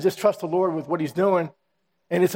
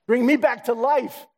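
slow plea lifted much higher; rising plea; 7 semitones higher, slower than usual. Acted. Praying for revival, slow and lifted high. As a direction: reach up with the words. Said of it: "Lord, give me that fire".

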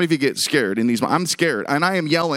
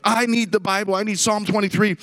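higher-pitched, mock-insistent demand; retold insistence; 6 semitones higher than usual. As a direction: imitate a kid who keeps demanding. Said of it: "incessant on".

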